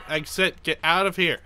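normal talking voice

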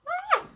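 high sudden shriek; startled yelp. Real, not acted. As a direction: yelp in surprise.

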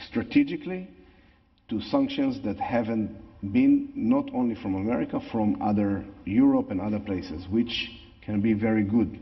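English with a natural Israeli accent. Real, not acted. Israeli speaker talking throughout.

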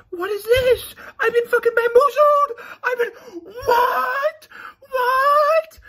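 very high, loud fake-crying fan wail; mock outraged fan. Fans reacting to Dream's face, mock sobbing fangirl wail. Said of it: "some people who go".

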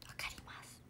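hushed agreeing whisper; soft whisper. Real, not acted. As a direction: breathe it out quietly. Agreeing about meat buns. (ささやき声で)

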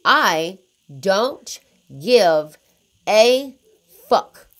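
each word split by pauses and hit hard. Real, not acted. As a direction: pause before every word, punch them loud. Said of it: "I'm gonna say it again".

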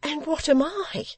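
very high, anguished question; high-pitched, incredulous widow; indignant cry. A narrator's reading. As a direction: throw it back at him, pitched high. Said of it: "she cried".

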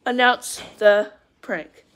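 spaced out, word by word; slow right down and separate each word; drawn-out, deliberate announcement; slow deliberate repeat. Real, not acted.

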